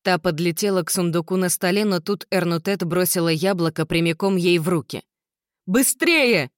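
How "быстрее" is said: high, loud urgent cry; shout. Acted. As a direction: yell to hurry them. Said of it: "закричала Изабель, дрожа от силы".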